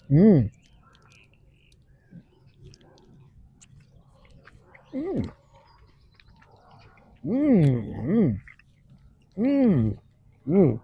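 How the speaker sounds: humming through a mouthful; mouth full. Real, not acted. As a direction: mm sounds while chewing. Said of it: "talking with my mouth full".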